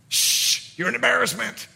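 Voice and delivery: hushing with a mild scold. Acted. Crowd silencing the beggar crying to Jesus, scolding hush. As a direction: shush him as an embarrassment. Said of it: "The crowd wanted him silenced".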